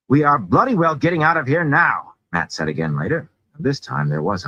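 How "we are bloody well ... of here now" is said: loud, high-pitched insistence; heated, emphatic outburst; exasperated demand. A narrator's reading. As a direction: demand it forcefully, fed up with arguing. Repeats his demand amid argument.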